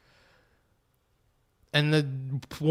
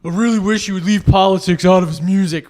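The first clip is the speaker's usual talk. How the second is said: loud, higher-pitched whiny-fan imitation; mock complaining fan; 4 semitones higher, 8 dB louder than usual. Fan griping about politics in Pink Floyd's music, mock indignant. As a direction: put on an indignant concertgoer, louder and higher. Mocks complaining [name] fans.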